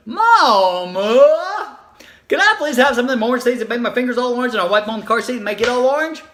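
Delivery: put-on high, fast, breathless begging child; pleading little child. Little girl begging her mom for chips, fast and pleading. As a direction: rush the whole wish out in one excited plea.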